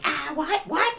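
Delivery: startled little goblins squealing; baffled goblins. Acted. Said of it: "they're like".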